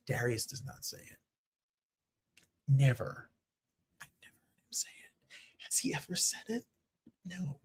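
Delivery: whispered aside. Real, not acted. Host whispers a gossipy aside about Darius. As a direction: lean in and whisper it. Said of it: "whispered aside about Darius".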